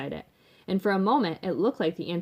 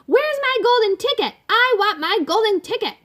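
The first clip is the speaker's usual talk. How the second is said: put-on very high, loud spoiled-girl shriek; screaming child's tantrum; 9 dB louder than usual. A narrator's reading. Veruca screams at her father for a ticket, tantrum shriek. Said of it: "she would scream at me".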